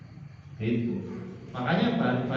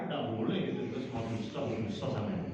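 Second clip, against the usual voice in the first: hushed aside; 4 semitones lower, 9 dB quieter, faster than usual. Acted. Acted hushed aside at funeral.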